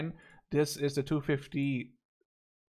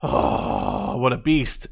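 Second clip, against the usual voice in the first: awed; 4 dB louder, much slower than usual. Real, not acted. Amazed by the tank stats.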